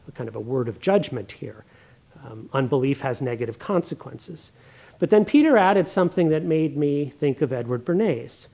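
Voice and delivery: ordinary talk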